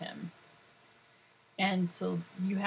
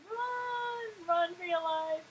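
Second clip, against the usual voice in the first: high, thin pretend cry of alarm; hammed-up warning to flee; mock panic; 12 semitones higher, much slower than usual. Mock warning to phone workers.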